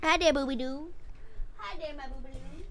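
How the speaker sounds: put-on high cooing baby-talk voice. Friend coos at a pet in sing-song. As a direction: coo at them like a puppy.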